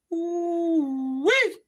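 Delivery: high, delighted squeal of admiration; excited ooh-wee over a great figure; delighted whoop. Real, not acted. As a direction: whoop like you just found treasure.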